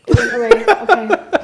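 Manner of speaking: high, laughing with relief. Real, not acted. Sneeze vanished, laughs.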